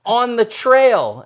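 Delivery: loud, high, drawn-out call; the guide yelling where the bear is, high and slow. Retold or imitated. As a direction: stretch it out like shouting down a slope. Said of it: "and he's like".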